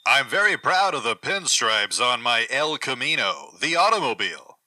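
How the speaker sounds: ordinary talk, nothing marked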